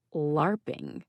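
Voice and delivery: faux comprehending, quiet question; fake understanding. A narrator's reading. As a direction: pretend to get it. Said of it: "faux comprehending tone".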